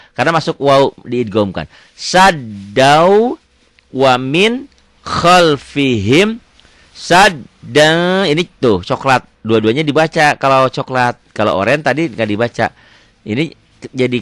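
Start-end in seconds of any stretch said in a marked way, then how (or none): 1.9-8.3 slow, drawn-out chanted recitation; Quran recitation. Shown as a demonstration. Teacher recites the verse for beginner students, slow and chanted. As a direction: chant each word slowly and loud.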